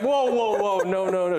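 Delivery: quick high alarmed protest; alarmed whoa. Real, not acted. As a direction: stop them in a hurry. Stopping partner from taking milk.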